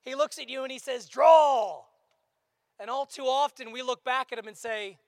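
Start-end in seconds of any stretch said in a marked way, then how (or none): 1.1-1.8 showdown command. Acted.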